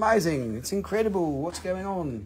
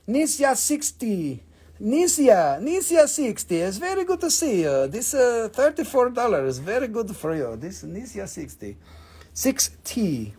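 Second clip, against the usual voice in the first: put-on voice; 4 semitones higher than usual. Greeting buyer Nisia in a put-on goofy voice. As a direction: play a goofy character for the buyer. Odd voice reading buyer name.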